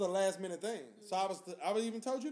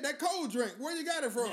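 higher-pitched imitation of a local woman; New Orleans woman; 6 semitones higher than usual. Woman repeats herself after his "huh", imitated higher-pitched insistence. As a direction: repeat the question up high, imitating her. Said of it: "She said".

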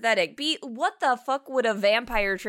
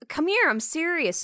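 put-on higher-pitched quoted boyfriend; mock insistence; 7 semitones higher than usual. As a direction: lightly act out a lover's plea. Acted lovers' quarrel from film.

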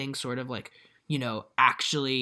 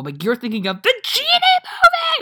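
laughing, cracking full-volume yell, much higher; screaming at her; 9 dB louder than usual. Retold or imitated. Exasperated friend screaming about the genie movie, shouted high. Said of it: "I was, like, screaming at her".

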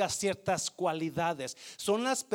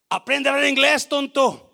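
mock rude scolding; 7 semitones higher, 12 dB louder than usual. Imagined rude coworker.

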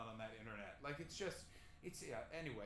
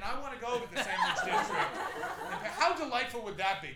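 giddy excitement; 7 semitones higher, 10 dB louder than usual. Real, not acted. Excited fantasy of Sandwich District.

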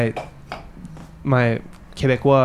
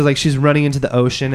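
ordinary delivery

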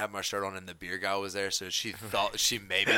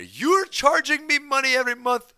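put-on high, loud Indian accent complaint; 11 dB louder than usual. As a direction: mimic an angry customer in an Indian accent. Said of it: "my attempt at, like, an Indian or Pakistani accent".

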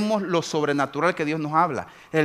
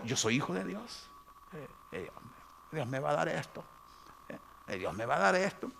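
much softer, hesitant claiming; mumbled boast; 11 dB quieter than usual. Retold or imitated. Believer outside church, boasting weakly and quietly. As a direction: mumble it unsure.

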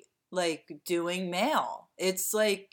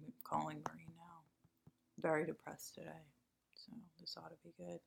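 whispering; 17 dB quieter, faster than usual. Real, not acted. Aside whispered before the call.

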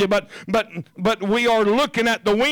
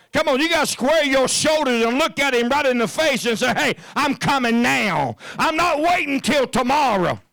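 higher, urging preacher's push; preaching exhortation; 7 semitones higher than usual. Acted. Preacher urging his congregation to bold faith, rising. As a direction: rouse them to step out.

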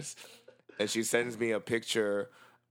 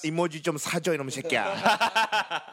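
higher, quicker Korean telling-off; mock scolding; 7 semitones higher, 4 dB louder, much faster than usual. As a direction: scold him in Korean, playfully exasperated.

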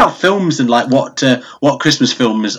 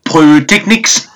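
put-on South African accent, drawn-out delivery; slower than usual. Shown as a demonstration. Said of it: "can say in a South African accent".

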